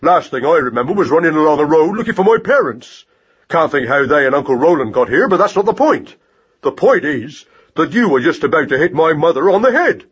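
indignant mouse tirade, rising on outbursts; raging torrent. A narrator's reading. Mouse berating rat-catcher for threatening his mother, indignant tirade. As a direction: scold hotly without letting up. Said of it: "positive raging torrent of words".

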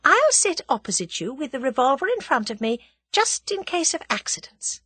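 high, light, politely in control; breezy courtesy with the upper hand; pleasant. A narrator's reading. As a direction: give orders as pleasant suggestions. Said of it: "she said pleasantly".

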